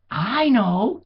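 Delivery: loud, high eureka; sudden idea. A narrator's reading. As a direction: burst out with the idea. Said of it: "he said".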